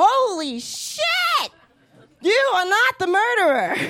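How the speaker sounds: high, slow dramatic reveal; talk show host reveal. Retold or imitated. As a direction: stretch out the shock of the announcement. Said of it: "rip open an envelope and go".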